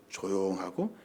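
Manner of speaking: low, hushed demonstration; quiet, deep voice. Shown as a demonstration. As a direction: drop down soft and deep. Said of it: "세미한 목소리로".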